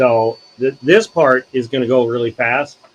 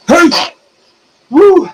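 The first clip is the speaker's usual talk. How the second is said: excited whoop; 11 dB louder than usual. Real, not acted. Streamer cheering a new viewer.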